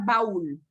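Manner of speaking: "baúl" as one syllable, "bául"; reading without accent. Shown as a demonstration. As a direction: stress the A of "baúl" and glide into U. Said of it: "Si aquí no hubiera tilde".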